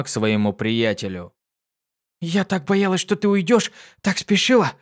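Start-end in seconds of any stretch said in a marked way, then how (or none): 2.2-4.7 запыхавшись, высоко и торопливо; тараторить, едва переведя дух; затараторила. Acted. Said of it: "затараторила она, чуть отдышавшись". (Дитси запыхавшись тараторит другу, взволнованно)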